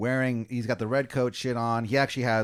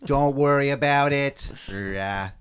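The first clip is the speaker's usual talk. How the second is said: louder, overdone bad Boston accent; awful Boston accent; 3 semitones higher, 6 dB louder, slower than usual. Colonists covering for their drunk friend, awful Boston accent. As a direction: push the terrible Boston accent hard. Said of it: "easily the worst Boston accents".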